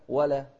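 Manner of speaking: ولا loud with a thin, clear l; light lam. Shown as a demonstration. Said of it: "كذلك في اللام". (ولا بلام مرققة)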